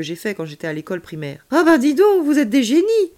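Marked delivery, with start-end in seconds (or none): 1.5-3.1 high, loud exclamation of admiration; awed. A narrator's reading. Child hearing alien built gadget, awed. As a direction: burst out impressed, like an excited child.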